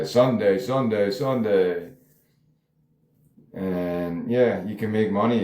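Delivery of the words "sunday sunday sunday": booming monster truck announcer. Retold or imitated. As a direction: hype it like a monster truck rally ad. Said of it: "or the monster truck guy".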